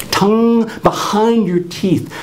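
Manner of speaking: high, slow instruction; speech therapist coaching. Retold or imitated. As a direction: stretch it out like a patient speech therapist. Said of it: "she says".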